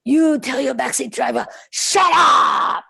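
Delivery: put-on high, loud accented command building to a shout; Zen master's accented shout. Zen teacher ordering students to silence their thoughts, shouting. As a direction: bark the order like a strict Korean Zen teacher. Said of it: "he had this very strong accent and he would say".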